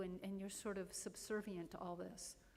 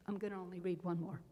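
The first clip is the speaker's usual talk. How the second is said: louder, measured prayer recital; prayer reading; 8 dB louder than usual. Acted. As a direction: read the prayer with solemn weight. Reads prayer aloud to congregation.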